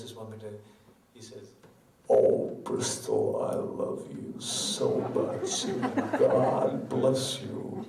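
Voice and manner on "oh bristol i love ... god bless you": slow, breathy American rock-star croon; deep American rock star. Shown as a demonstration. A singer crooning to the crowd in a deep American accent. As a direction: lean into the mic like an American arena star. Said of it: "in an American accent ... as deep a voice".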